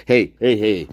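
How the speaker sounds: ordinary delivery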